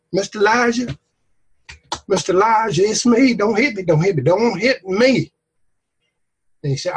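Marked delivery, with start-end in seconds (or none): 0.1-3.3 higher, nervous soldier voice; a scared soldier edging closer; timid plea. Acted. Frightened soldier voiced in story. 3.3-4.2 higher, hurried don't hit me; mock begging. Frightened soldier begging in story. 4.2-5.3 higher, slow don't hit me; drawn-out plea. Acted. Frightened soldier begging in story.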